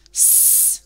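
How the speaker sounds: S as a long snake hiss; S da serpente. Shown as a demonstration. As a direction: hold out the sound S like a snake. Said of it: "como se hacen los serpientes".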